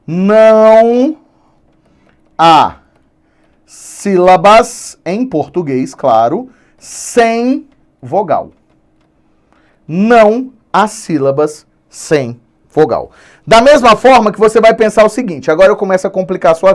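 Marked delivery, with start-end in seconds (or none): 0.0-8.5 "Não há sílabas sem vogal" spelt out word by word, long pauses, slow; slow emphatic rule. Shown as a demonstration. Teacher dictating the key rule slowly for students to note. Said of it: "eu não posso esquecer nunca, ó". 9.9-13.1 "Não há sílabas sem vogal" slow, stress on "Não"; slow rule restated. Shown as a demonstration. Teacher repeating the rule slowly so students write it down. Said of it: "eu não posso esquecer nunca".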